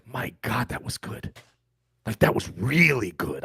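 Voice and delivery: very soft, near-whispered realization; hushed awe. Acted. Viewer realizing days later how good it was, acted hushed awe. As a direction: let it dawn on you, barely voiced.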